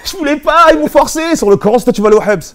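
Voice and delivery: high, loud mock whining excuse; mock pleading protest. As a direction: plead it in a hammed-up squeal. Mimicking someone pleading innocence, very high.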